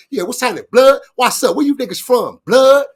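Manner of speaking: acted gang greeting turning into a challenge; gangbanger challenge. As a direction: bang on him like a hard street gang member. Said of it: "I went straight in the improv character on him".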